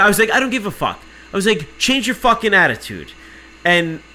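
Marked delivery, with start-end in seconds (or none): 0.3-1.0 higher, heated dismissal; retold quarrel voice; defiant retort. Arguing back at agent. 1.8-3.1 high, loud retold telling-off; heated, indignant order; angry scolding. Scolding the agent in quarrel.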